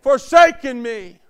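loud, slow anguished cry. Retold or imitated. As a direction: stretch it out as a desperate cry to heaven. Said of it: "when Jesus cried out".

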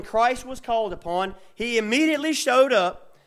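builds to a loud preacher's cry; preaching at full force. Real, not acted. Preacher to his congregation about Christ showing up, at full force.

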